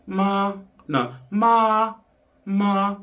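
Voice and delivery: MA repeated high, testing the pitch; high tone attempts. Shown as a demonstration. Searching for the tone before viewers, high MA. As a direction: try "ma" high and held, correcting yourself. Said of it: "I can't demonstrate it so well".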